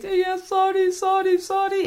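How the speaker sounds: put-on high, pleading little child's voice; crying child. Child apologising in tears to the angry parent, high and pleading. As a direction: whimper the sorries like a scared kid. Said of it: "Of dan komt die wenen".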